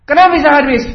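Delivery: high, very loud demand; acted furious shout; even angrier boss. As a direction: yell in outraged disbelief. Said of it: "tambah marah lagi dia".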